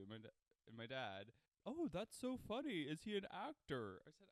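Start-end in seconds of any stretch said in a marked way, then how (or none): 1.7-4.0 higher, louder, excited parent voice; imitated dad. Dad reacting to news of the lookalike, mock excitement. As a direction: act out a parent's delighted reply. Voicing dad's reply.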